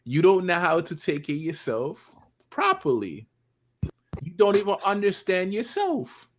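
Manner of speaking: put-on loud, high Jamaican-accented rant. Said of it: "I heard your Jamaican accent almost come out".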